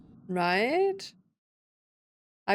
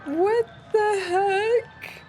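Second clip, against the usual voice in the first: high, very slow, drawn-out exclamation; stunned disbelief; 11 semitones higher, much slower than usual. Real, not acted. Player reacting to a shocking game scene, stunned disbelief. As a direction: stretch each word high in disbelief.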